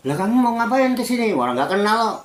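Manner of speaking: suddenly surprised by a stranger at the door; startled widow. Acted. (janda yang kaget, suara lebih tinggi)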